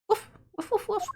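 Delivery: high yappy woofs for the little dog; dog barking. Acted. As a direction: bark it out like an excited puppy. Streamer voices dog Missile barking.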